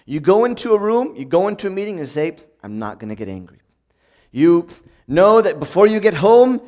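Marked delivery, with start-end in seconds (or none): 2.6-3.6 much softer and lower, said to oneself; soft inner vow; quiet resolve. Retold or imitated. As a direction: murmur a calm promise to yourself. Self-resolution before meeting.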